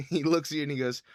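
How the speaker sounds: ordinary talk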